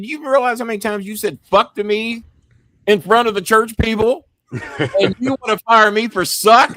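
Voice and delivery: loud, high-pitched protest; high, incredulous mock outrage; retold indignant argument. Retells arguing back at his boss.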